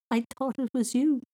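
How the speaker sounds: put-on high, giggly child's voice; high-pitched amused boy; giggled. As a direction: speak just out of a giggle. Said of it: "he giggled".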